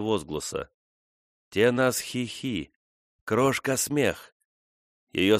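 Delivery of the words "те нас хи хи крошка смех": slow, wondering repeat of a name. A narrator's reading. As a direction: say it slowly, remembering. Frona repeats her old nickname.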